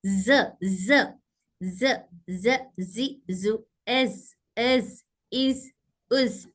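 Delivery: ज voiced low, bold and solid; low solid ज demo; bold heavy z. Shown as a demonstration. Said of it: "आवाज bold और solid होती है".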